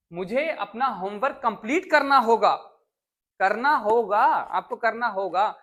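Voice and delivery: "करना होगा" hit loud and firm; stressed करना होगा. Shown as a demonstration. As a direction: press down on "करना होगा" slowly. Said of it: "teacher stressing करना होगा". ("करना होगा" पर ज़ोर, धीमा और ज़ोरदार)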